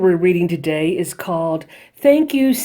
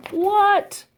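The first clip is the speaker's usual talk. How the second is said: mock surprise. Mock shocked reaction to news.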